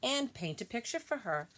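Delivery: gentle, measured read-aloud voice; storybook reading voice; reading to children. Acted. Reads picture book to class.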